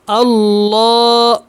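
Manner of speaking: اللہ drawn out with thick ل; heavy lam. Shown as a demonstration. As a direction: fill the ل of اللہ. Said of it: "اس کو موٹا پڑھیں". (اللہ کا لام موٹا)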